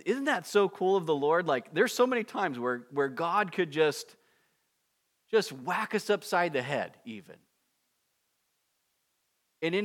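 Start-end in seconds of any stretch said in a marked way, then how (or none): none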